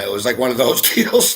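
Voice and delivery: normal talking voice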